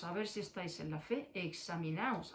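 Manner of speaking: examinaos, soft and low; soft reminder. Shown as a demonstration. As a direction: drop examinaos to a quiet aside. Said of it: "Dios dice".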